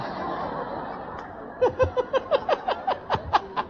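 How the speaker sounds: high breathy burst of laughter; a quick high laugh. Real, not acted. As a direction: laugh at his own joke. Laughs at the reversed saying.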